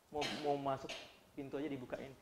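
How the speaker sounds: ordinary delivery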